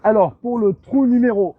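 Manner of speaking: loud, slow hole announcement; golf commentator. Shown as a demonstration. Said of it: "Oui, je vais parler au micro".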